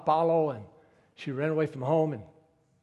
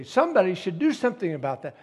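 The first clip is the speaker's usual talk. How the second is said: mocking what we all say; 4 semitones higher, 8 dB louder than usual. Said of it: "we say".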